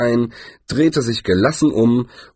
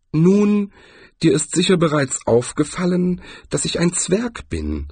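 lightly voiced dwarf, pitched higher; a hint of a grumbling craftsman, raised pitch; 8 semitones higher than usual. A narrator's reading. Dwarf explaining himself to Cohen, mildly grumbling. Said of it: "brummte der Juwelier".

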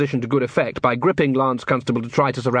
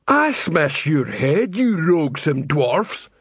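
slow, higher troll voice; threatening troll; 3 semitones higher, much slower than usual. Acted. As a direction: drag the threat out as an angry troll.

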